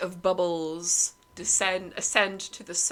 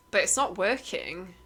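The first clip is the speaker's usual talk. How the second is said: ordinary delivery, nothing marked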